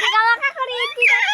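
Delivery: laughing shout while tickled; squealing with laughter; being tickled. Real, not acted. (tumatawang sigaw habang kinikiliti)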